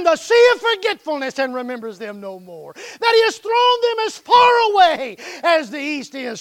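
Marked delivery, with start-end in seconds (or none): 2.7-6.4 loud, high shouted line; preaching shout. Acted. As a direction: shout it out to the church.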